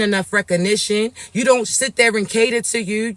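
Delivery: ordinary talk, nothing marked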